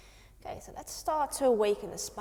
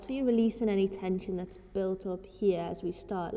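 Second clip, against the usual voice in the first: ordinary delivery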